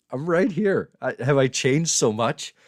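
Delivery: high, loud, incredulous protest; mock indignant protest. Real, not acted. Streamer answering viewer who says he's changed, mock indignant. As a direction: raise voice and pitch in mock offence.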